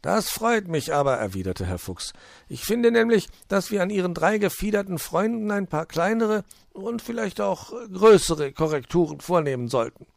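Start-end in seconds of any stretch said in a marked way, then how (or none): 0.0-1.2 high, loud, jolly fox voice; high-pitched welcoming fox; cheerful fox. Acted. Fox voice held. 2.5-10.1 high, loud, jolly fox voice; high-pitched welcoming fox; cheerful fox. Acted. Fox voice held.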